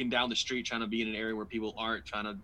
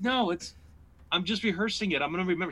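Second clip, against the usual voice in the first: high-pitched, louder objection; mock-defensive, pitched up high; defensive protest; 8 semitones higher, 4 dB louder than usual. Husband brushing off wife's advice, defensive and high.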